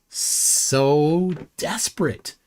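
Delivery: very slow, stretched-out dismissal; drawn-out scorn. Real, not acted. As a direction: stretch each word out in disbelief. Mocking flat-earthers' excuses.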